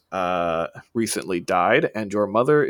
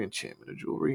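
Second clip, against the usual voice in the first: whispered to himself; 9 dB quieter, faster than usual. Acted. Shopkeeper still searching the shelves, now only whispering.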